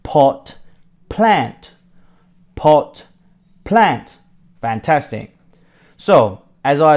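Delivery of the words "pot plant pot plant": "pot" and "plant" split apart with a pause between; slow model word. Shown as a demonstration. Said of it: "Say it with me".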